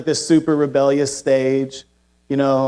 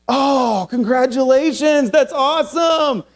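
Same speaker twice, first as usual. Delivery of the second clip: gushing proud mom; 9 semitones higher, slower than usual. Retold or imitated. Mom praising son's win.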